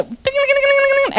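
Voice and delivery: high mock alarm ringing, ding ding; alarm imitation. Pastor mimics the alarm going off, high mock ringing. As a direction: ring it out high like a fire alarm.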